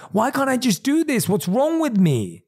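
high, exasperated self-scolding; frustrated self-criticism. Acted. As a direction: snap at yourself in frustration.

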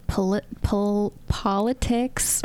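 slow, halting false starts on "politics"; nervous word stumble. Real, not acted. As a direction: trip over "politics", restarting it twice before getting it out. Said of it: "got nervous and started saying words wrong".

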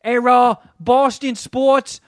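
drawn-out mock Boston accent boast. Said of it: "they're like".

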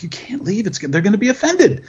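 acting out his own anxious insistence; mock urgent plea. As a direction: plead with friends in an exaggerated high voice. Retold plea to restless friends.

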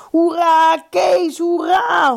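high, loud, stretched hurrah; cheering. A narrator's reading. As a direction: cheer from every side. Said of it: "van alle kanten roepen".

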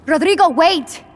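calling out. Acted. Woman chasing after Rodrigo, calling out.

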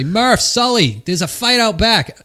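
loud, high, drawn-out call across a bar; acted call-out. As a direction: holler slowly to summon your buddies.

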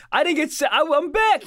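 high, loud hammed-up announcement; mock returning deserter. Comedian as girl back from cigarette run, mock excited. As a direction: play someone bursting back as if nothing happened. Mother returning after leaving family, acted.

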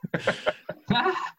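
breathy, very high burst of laughter; high airy laugh. Real, not acted. Listener laughs at the pun.

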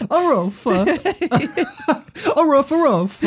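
high laughing woof; dog bark. Pretend. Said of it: "an Italian dog barks".

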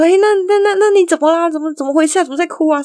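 high, flustered stammering questions; mock alarm. Mum asking the crying caller what happened, mock alarm.

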